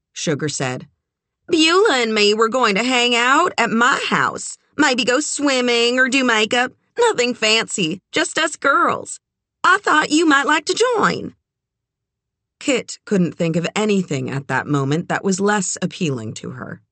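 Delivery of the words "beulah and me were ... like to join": high, bubbly small-town lady; sweet pushy Southern belle; chatty Southern woman. Acted. Narrator voices Sugar.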